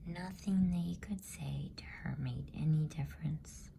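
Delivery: ordinary delivery, nothing marked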